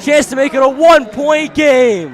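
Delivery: big, high, drawn-out call; yelling the and-one call; excited shout. Real, not acted. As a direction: roar it like a live buzzer moment. Live call of and-one play.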